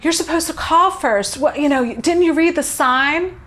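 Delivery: snippy receptionist scolding a client who walked in uninvited. Retold or imitated. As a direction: play the annoyed front-desk lady telling her off. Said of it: "the receptionist's like".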